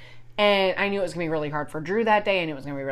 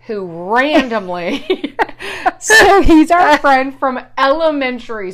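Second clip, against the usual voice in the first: louder, higher, laughing through the words; 6 semitones higher, 11 dB louder, slower than usual. Real, not acted. Telling listeners about a random guest, laughing. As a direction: break into a laugh mid-explanation.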